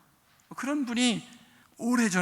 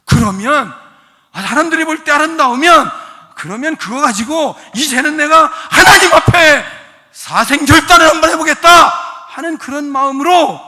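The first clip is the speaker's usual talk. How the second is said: loud, raised-pitch exhortation; full-force preaching; 5 semitones higher, 15 dB louder than usual. Real, not acted. Preacher reasoning with the congregation about character, loud and forceful. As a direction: drive each phrase out to the hall.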